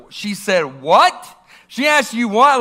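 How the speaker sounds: a father hearing the insult, going off; outraged disbelief. Retold or imitated. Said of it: "I went nuclear in a second".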